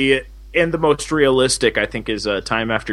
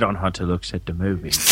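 lower, hammed-up British accent; mock British accent; 8 semitones lower, 4 dB quieter than usual. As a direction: announce the show like a cheeky Brit. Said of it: "sexy British accent of yours".

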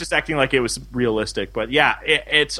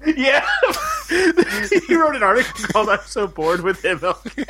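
laughing through it; 10 semitones higher than usual. Real, not acted. Reads title while laughing.

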